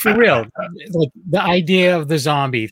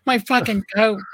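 higher, outraged complaint about the coat; exasperated whine; 8 semitones higher than usual. Retold or imitated. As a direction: whine it like a fed-up victim.

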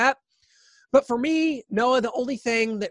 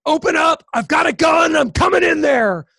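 loud, high shouted demand; mock menacing shout; threatening ex; 7 semitones higher, 6 dB louder than usual. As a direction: imitate a gunman yelling at the door. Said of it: "It finally comes to him saying".

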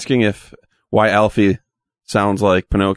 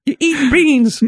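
squeaky elf voice; much slower than usual. Pretend. Alfie voice continues.

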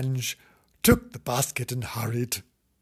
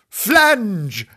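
high, loud call of "Flange!", falling; 8 semitones higher, 8 dB louder than usual. Acted. Wife calls out for her husband, loud and high. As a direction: shout "Flange!" across the house. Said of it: "she cried".

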